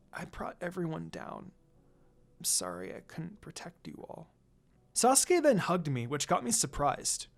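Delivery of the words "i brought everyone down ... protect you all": broken voice. A narrator's reading. Lucy apologizing to Sasuke while crying, broken and soft. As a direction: let it crack with held-back tears. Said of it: "I spoke in a broken voice".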